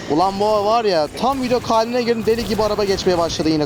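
high-pitched, fed-up complaint; exasperated rant. Real, not acted. Gamer annoyed by cars passing mid-recording, exasperated rant. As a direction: vent your irritation at the noise.

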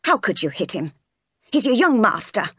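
a touch of shocked reproach; lightly voiced disapproving maid; scolding. Miss Abbott reproving Jane for hitting, mildly scolding. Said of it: "both started scolding me".

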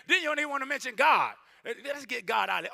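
normal talking voice

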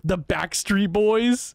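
high, slow, delighted reveal; amused reveal. Real, not acted. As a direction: draw out the name with glee.

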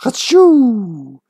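fake sneeze. Faking a sneeze after counting.

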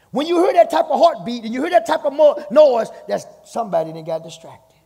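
loud, high preaching cry; preaching at full force. Real, not acted. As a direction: lift the room, shout it out high.

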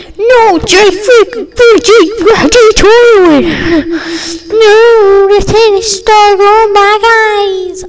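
high, wailing pretend cry. Kid voicing an upset toy student over the cards, pretend crying.

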